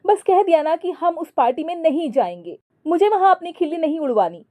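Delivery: high, fast angry outburst; wife shouting she won't go to the party. A narrator's reading. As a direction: shut the argument down with fast, high anger. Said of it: "जूही फिर से गुस्से से बोली".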